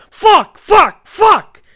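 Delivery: high, loud bursts of cursing; pained yelling. Retold or imitated. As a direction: yell each word as a separate jolt of pain. Imitates friend's yells at each bump.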